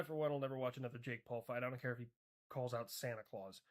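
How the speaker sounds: ordinary delivery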